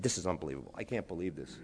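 awed hush. Retold or imitated. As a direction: breathe it out in stunned wonder. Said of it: "I said".

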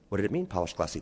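normal talking voice

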